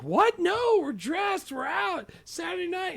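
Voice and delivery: high, slow mock scream; retold scream. Wife refusing to leave the date, mock screaming. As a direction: shriek it out in disbelief. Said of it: "And she's, like, screaming".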